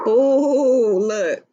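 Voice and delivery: ordinary talk, nothing marked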